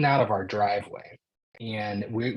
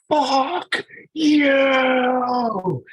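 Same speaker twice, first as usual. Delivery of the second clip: much higher, louder imitated scream; screaming at top of lungs; 9 dB louder than usual. Husband imitating his wife screaming after the car, very high and loud. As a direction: hurl it down the street at full volume. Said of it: "Just screaming it at the top of her lungs".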